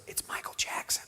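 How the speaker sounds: whispered, as if sharing a secret; hushed awe. Retold or imitated. Friend reveals who is calling, awed whisper. Passing on a starry phone call.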